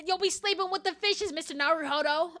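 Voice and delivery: bad New York accent. Second go at threatening Mister Naruhodo, exaggerated New York accent. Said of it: "how to do a New York accent".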